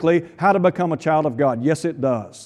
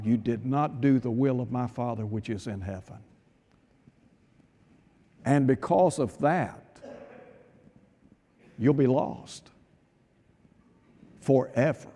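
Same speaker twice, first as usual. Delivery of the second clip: quiet solemn rebuke; 5 semitones lower, 8 dB quieter than usual. Acted. Jesus quietly telling the lost they failed the Father. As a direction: hush it like a final verdict.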